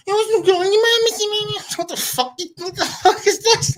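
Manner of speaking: baffled mock Doric. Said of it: "All I was hearing was like".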